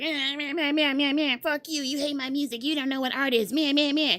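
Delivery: a hint of a whiny aggressive rapper; mock aggressive rant. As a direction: parody a ranting rapper, meh meh. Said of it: "If you gonna be all aggressive".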